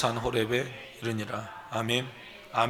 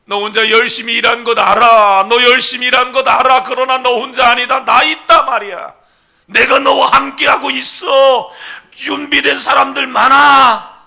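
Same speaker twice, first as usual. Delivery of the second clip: God's booming voice; 12 semitones higher, 18 dB louder than usual. Acted. Pastor voicing God answering Elijah, booming shout. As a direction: boom it out as God's promise.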